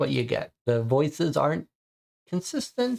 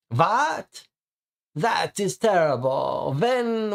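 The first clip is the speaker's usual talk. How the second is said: shocked vampire; 5 semitones higher, slower than usual. Acted.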